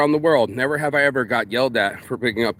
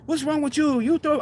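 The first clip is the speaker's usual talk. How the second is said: much higher, mocking the bar girl; 12 semitones higher than usual. Imitating the Thai bar girl scolding him, high. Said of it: "the girl was like".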